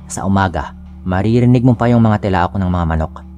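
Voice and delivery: plain reading voice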